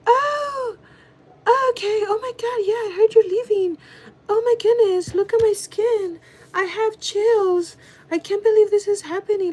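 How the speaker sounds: mock coworker gushing, very high-pitched; fake gushing reaction. Coworker reacting to the news of leaving, fake gushing. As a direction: put on a high, over-the-top fake voice. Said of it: "That was the fakest reaction".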